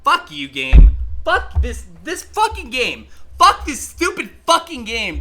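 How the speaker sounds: high, loud outburst at the game; angry cursing. Real, not acted. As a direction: rage at the game that just beat you. Cursing the game after losing.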